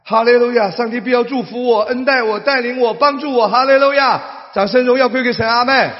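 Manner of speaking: loud, high rising proclamation; preaching at full force. Real, not acted. Preacher rousing the congregation to praise, full shout.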